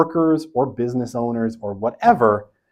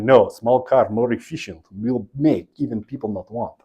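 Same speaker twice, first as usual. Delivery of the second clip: put-on Soviet planner accent, pitched like usual talk; mock Soviet accent. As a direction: play a central planner with a thick Eastern European accent. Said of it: "why I did that accent".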